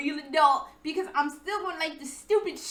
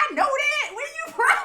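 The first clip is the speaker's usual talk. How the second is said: high, loud laughing shout; laughing retort; 12 semitones higher, 8 dB louder, faster than usual. Retold or imitated. Mimicking Danny laughing at her answer, loud and high.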